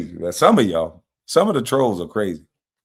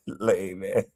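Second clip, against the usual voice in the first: ordinary talk, nothing marked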